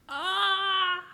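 loud, high "ah" cry; startled yell. Real, not acted. Cries out as dog interferes.

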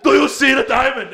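high, loud retort said again; mock retort. Repeating the comeback for the greeter, a loud mock retort. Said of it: "you should have went".

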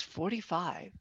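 put-on soft, worn-out old woman's answer; imitated aged-sounding woman. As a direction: answer very quietly, as if far older. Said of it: "her voice sounded ninety".

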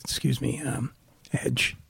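softly, hesitantly getting someone's attention; retold starstruck meekness, voice dropped low in volume; timid approach. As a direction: say it small and tentative, like a nervous fan. Retelling his starstruck approach to idol.

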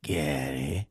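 lightly voiced director shooing him out; a hint of a gruff director; director dismissing. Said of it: "he goes".

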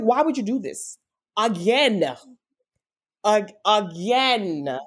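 high-pitched, pleading protest; retold cry of reproach, dragging out the last "again"; anguished anger. Grieving daughter demanding an answer from God, anguished anger. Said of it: "I was so angry with God".

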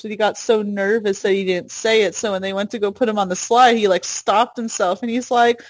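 usual speaking voice

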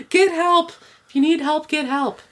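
emphatic, high mock self-help voice; mock preachy advice. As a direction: parody the book's earnest message. Mock message of the book.

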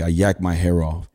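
normal talking voice

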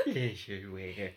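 very slow mimicking "Leisure wear"; mock Scottish. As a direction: tease by copying her slowly.

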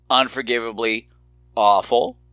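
ordinary talk, nothing marked

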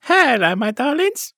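high-pitched panto catchphrase; high comic greeting, starting loud. Acted. Comedian coming on in panto, high-pitched greeting. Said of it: "Panto [name] comes on".

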